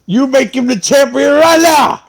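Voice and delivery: loud, high commanding yell; mock boss shout. Podcaster playing a wrestling boss demanding a title, loud.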